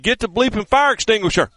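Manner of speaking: hammed-up yell at the autograph seekers; mock yell. Host retelling Richard Petty cursing at crew, mock yelled. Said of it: "He said".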